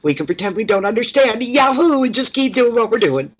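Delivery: high, gleeful mischief; voice of naughty pets. Pretend. Owner voicing pets who ignore her, high mischievous voice. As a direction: play two-year-olds getting away with it. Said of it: "but they're going".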